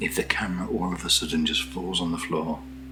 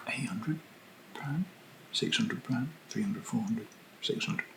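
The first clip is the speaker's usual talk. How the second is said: higher, softer, unsure rising tune; questioning herself; 6 semitones higher, 7 dB quieter than usual. Real, not acted. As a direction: trail through the numbers as if double-checking. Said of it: "Questioning myself".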